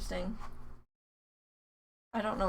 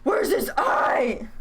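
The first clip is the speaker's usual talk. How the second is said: mock panic; 5 semitones higher, 6 dB louder, much slower than usual. Hunting for the lost eye.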